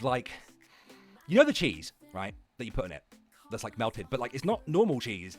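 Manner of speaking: ordinary delivery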